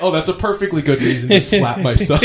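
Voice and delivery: sarcastic gangster voice with a chuckle in it; mock mob boss. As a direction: play the boss calmly shrugging it off, hammed-up and loud.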